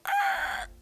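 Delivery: short, very high, loud shriek; mock scream. People reacting to the undead, high mock scream. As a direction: imitate a crowd's panicked yelp. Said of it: "people are like".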